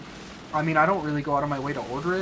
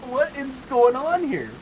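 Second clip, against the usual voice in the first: high, loud and slow disbelieving cry; exasperated disbelief; 11 semitones higher, 6 dB louder, slower than usual. Real, not acted. Gamer yells at enemy that won't die, high slow disbelief. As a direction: stretch it out, baffled and fed up.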